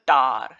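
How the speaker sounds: "tar" stressed, with a long full A; strong syllable demo. Shown as a demonstration. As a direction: lean on "tar" and let the vowel ring out complete. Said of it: "A is able to come here with the, its complete sound".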